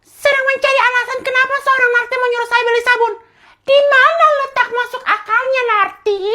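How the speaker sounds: very high, loud pompous royal voice; king mocks the mother's request in a squeaky voice; squeaky haughty king. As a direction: look down on the servant from a squeaky throne.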